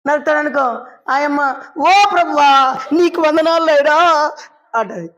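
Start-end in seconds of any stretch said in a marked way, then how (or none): 1.8-4.3 slow, loud cry to the Lord; drawn-out loud plea; mock wailing prayer. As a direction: wail it out like a desperate wife. Wife crying out to God.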